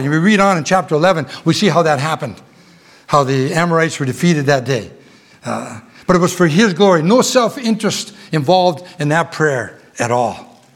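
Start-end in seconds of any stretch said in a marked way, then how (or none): none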